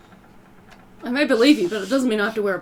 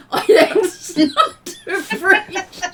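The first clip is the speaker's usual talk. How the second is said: giggling through the words; laughing; 7 semitones higher, much slower than usual. Real, not acted. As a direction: laugh while you say it. Laughing through in-game declaration.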